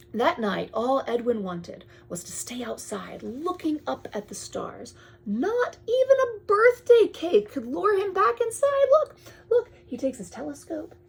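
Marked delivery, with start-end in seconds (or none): 5.2-9.7 higher, louder eager storytelling; thrilled read-aloud to children; excited reading. Acted. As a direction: bubble over with excitement.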